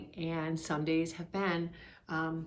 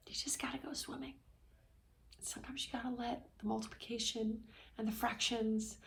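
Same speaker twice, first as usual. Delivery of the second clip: whisper; 3 semitones higher, 10 dB quieter than usual. Real, not acted. Hushed advice to moms.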